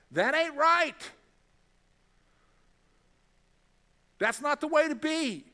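God sternly correcting a wayward heart; stern rebuke. Acted. Preacher voicing God scolding the listener, stern.

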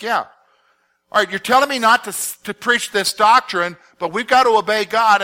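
acted-out defiant reply; defiant retort. Acts Peter answering the council back.

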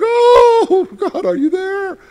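high, loud anguished call to God; desperate cry. Retold or imitated. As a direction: cry out to God like you're lost. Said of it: "And I'm like".

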